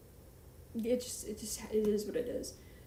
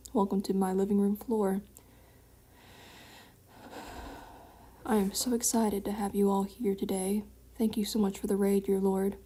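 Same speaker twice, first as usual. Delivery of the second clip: mock grand host welcome; 4 dB louder than usual. Greets raid in mock host voice.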